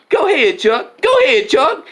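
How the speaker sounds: Nancy egging Chuck on. Acted.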